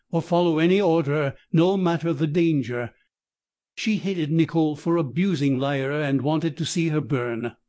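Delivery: ordinary delivery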